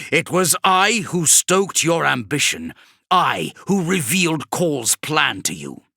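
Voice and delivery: taunting demon. Acted. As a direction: lord it over the fool.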